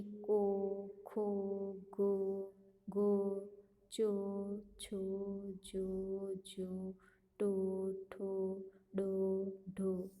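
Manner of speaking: slow, deliberate chant of each syllable; syllable drill recitation. Shown as a demonstration. Teacher reads the o-vowel chart aloud to pupils, measured and clear. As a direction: recite the chart one syllable at a time for children.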